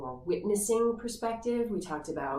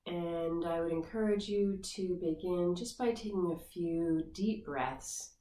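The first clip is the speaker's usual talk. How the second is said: guided meditation. Acted. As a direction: ease the listener into the body scan gently. Leads guided body scan meditation.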